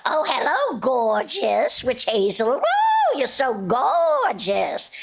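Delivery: high, slow, cackling witch hazel; a flirty cartoon witch swooning, with a high whoop. Shown as a demonstration. Witch voice done to make a fan grin. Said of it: "easier for me to just go".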